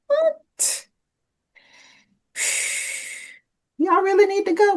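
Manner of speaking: high-pitched disbelieving question; mother to kids asking about the funeral, high and incredulous. Retold or imitated. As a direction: ask it high, unable to believe it.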